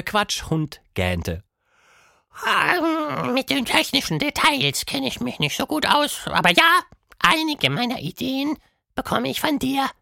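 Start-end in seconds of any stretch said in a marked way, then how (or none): 2.4-9.9 sehr hohe Monsterstimme, beiläufig; Quatschmonster, lässig. A narrator's reading. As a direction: gelassen abwinken. Gähnt dabei. (der gähnende Quatschhund erklärt lässig)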